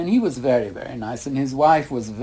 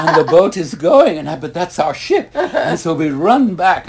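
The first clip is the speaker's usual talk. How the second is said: laughing while telling; 4 semitones higher, 6 dB louder than usual. Real, not acted. Retelling missing the ship.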